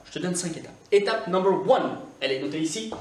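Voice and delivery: ordinary talk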